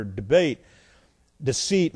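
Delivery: ordinary talk, nothing marked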